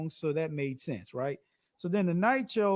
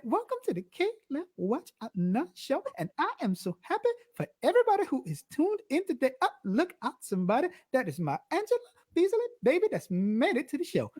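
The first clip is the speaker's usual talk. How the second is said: high show voice; 7 semitones higher than usual. Shown as a demonstration. Host welcoming viewers on the show, voice up high. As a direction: talk up high in the register, bright host welcome. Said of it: "when I talk up here".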